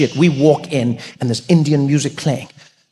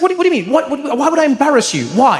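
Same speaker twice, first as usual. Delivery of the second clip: agitated friend; 11 semitones higher, faster than usual. Retold or imitated. Steve agitated.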